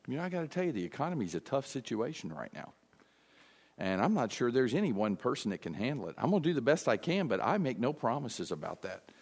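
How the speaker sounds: a lightly voiced wishy-washy candidate; hesitant candidate. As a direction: hedge it softly, like a candidate who won't promise.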